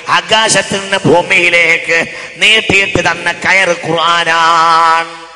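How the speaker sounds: loud chant with a long final syllable; sing-song preaching. Shown as a demonstration. Preacher translating scripture for the congregation, loud chanted preaching. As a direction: intone it, drawing out the last word.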